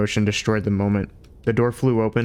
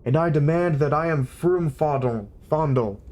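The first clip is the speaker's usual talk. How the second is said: shout; 6 semitones higher than usual. A narrator's reading. Younger philosopher shouting his name at the room, insistent. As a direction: shout your name as a demand. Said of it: "shouted the younger one".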